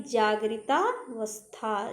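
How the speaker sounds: जागृतावस्था slowly, with a long 'taa'; joined compound. Shown as a demonstration. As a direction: draw out the join in जागृतावस्था. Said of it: "जागृत अवस्था नहीं". (जागृतावस्था धीरे, 'ता' लंबा)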